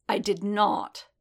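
slow, weighted words; heavy feeling. A narrator's reading. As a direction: lean hard on each word of "I did not". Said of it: "put so much feeling behind the word".

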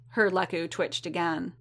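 plain reading voice

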